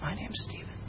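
whispered, ashamed mumble; barely audible. Retold or imitated. Young man introducing himself at a family event, whispered in shame. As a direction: say your name as if you can't speak. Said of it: "I was like".

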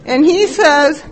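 ordinary delivery

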